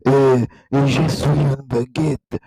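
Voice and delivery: slow, loud cartoon French accent; drawn-out, exaggerated French accent. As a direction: ham up a mock French voice. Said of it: "if you speak in French, you go".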